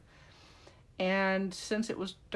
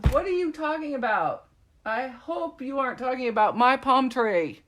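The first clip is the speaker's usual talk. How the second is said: high, loud mock indignation; mock offended; 5 semitones higher, 8 dB louder than usual. Crafter defends her palm tree to an imagined critic, in mock indignation. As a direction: play it hurt over the palm tree, higher and louder.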